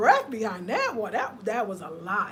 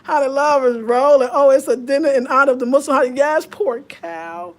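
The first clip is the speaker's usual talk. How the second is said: much louder, laughing chatter with viewers; excited laughing; 3 semitones higher, 12 dB louder than usual. Real, not acted. Vlogger reading live comments, loud and laughing. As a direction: ride the laughter, loud and lively.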